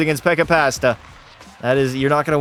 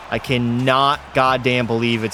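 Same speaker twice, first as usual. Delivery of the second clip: ordinary talk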